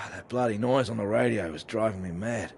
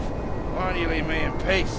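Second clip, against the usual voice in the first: irritated call; 5 semitones higher, 5 dB louder than usual. Acted. Calling out, irritated.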